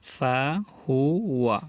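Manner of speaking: ফাহুয়া stretched very slowly, each sound held; drawn out. Shown as a demonstration. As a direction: drawl ফাহুয়া out for learners. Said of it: "teacher drawls then repeats". (ফাহুয়া খুব টেনে ধীরে ধীরে)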